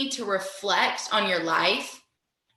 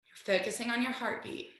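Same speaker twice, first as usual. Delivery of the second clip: much softer meditation voice; guided meditation; 7 dB quieter than usual. Acted. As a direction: guide them gently into stillness. Guides a mindfulness moment.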